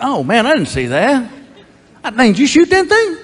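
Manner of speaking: high, amazed slow-witted buddy; dim-witted neighbor. Acted. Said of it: "he's just dumber than a bag of rocks".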